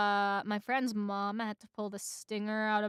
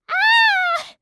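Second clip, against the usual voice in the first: high, loud scream; pained cry; 14 dB louder than usual. A narrator's reading. Woman stabs her hand with a fork, crying out. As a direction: scream out in sudden pain. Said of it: "then cries out".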